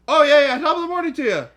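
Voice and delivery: loud, very high mock-cheery holler; exuberant re-enacted greeting. Coworker re-enacting how they joined the greeting, loud. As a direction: belt out a hammed-up hearty hello. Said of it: "I heard it and went".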